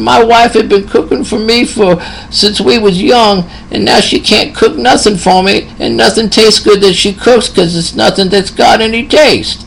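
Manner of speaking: high, lightly put-on old man's voice; elderly patient. Old patient quoted by nurse.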